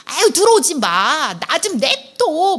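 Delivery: put-on high, whiny teen brushing off a parent; sulky teenager. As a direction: play the irritated teenager shutting the door. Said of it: "이런 얘기를 하는 아이들이".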